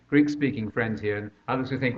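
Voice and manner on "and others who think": ordinary talk, nothing marked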